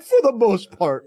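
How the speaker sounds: high, loud, hedging mock reply; teasing mock guest. Host teasing the guest about monogamy, mock hedging voice. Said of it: "you're like".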